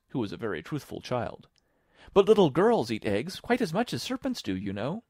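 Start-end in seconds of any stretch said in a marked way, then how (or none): 2.1-5.0 put-on high, loud girlish voice; high-pitched matter-of-fact argument; reasoning Alice. A narrator's reading. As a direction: explain earnestly as a little girl.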